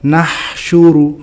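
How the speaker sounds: "Nahsyuru" slowly, h held and running into syu; flowing h. Shown as a demonstration. Teacher models rikhwah, sustained h in "Nahsyuru". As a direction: stretch the breath of h in "Nahsyuru". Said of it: "Karena dia mengalir".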